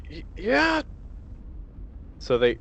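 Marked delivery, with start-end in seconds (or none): 0.1-0.8 nervous stammer. Acted. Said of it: "It's like".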